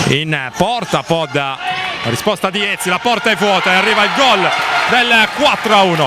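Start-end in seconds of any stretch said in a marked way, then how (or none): 2.0-6.1 breathless Italian goal commentary. Real, not acted. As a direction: shout the goal, racing with joy. Goal call in live commentary.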